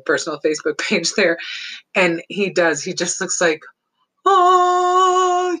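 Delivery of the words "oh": high, loud drawn-out oh of pure bliss; blissful cat. Retold or imitated. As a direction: voice the cat in heaven on his new tower. Voicing the cat's bliss.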